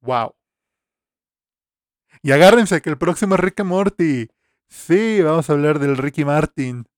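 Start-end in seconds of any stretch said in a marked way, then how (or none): 2.2-6.9 loud hyped-up. Pretend. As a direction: announce it like a game show host. Hyped announcement of next episode. (anuncio emocionado y fuerte)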